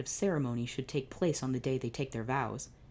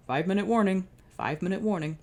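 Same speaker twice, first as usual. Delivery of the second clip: louder, higher announcer call; intercom announcement; 5 semitones higher, 5 dB louder, faster than usual. Acted. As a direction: call it over the PA system.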